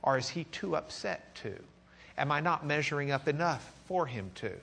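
softly voiced private doubt; anxious inner thought. Preacher voices a listener's doubts about God, softly wondering. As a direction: ask it quietly, as if only thinking it. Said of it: "Have you ever thought".

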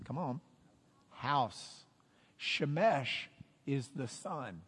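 normal talking voice

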